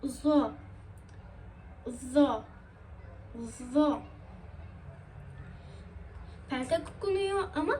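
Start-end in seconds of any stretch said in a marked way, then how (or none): 0.0-4.0 a heavy lisped Zo; thick lisped letter. Shown as a demonstration. Teacher showing students the thick lisped letter. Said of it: "peltek okunan kalın harfler".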